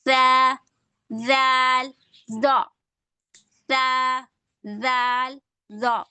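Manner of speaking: سا، زال، زو each said alone with the tongue tip on the teeth; teacher demonstrating tongue-tip letters. Shown as a demonstration. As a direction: model the three letters crisply. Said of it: "جیسے".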